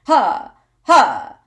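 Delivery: short loud, high breath pushes from the gut; two forceful diaphragm huffs. Shown as a demonstration. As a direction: puff air out sharply from the diaphragm. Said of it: "I'll do it again".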